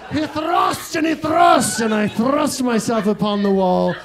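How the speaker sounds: put-on English accent. Shown as a demonstration. Comic recounting thrusts against the wall, mock English accent. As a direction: declaim it in a hammy English accent. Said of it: "I don't know why I go English".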